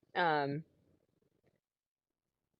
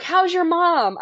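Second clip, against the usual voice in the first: bright eager greeting; 11 semitones higher, 8 dB louder, much slower than usual. Retold or imitated. Greeting a grown-up kid she knew.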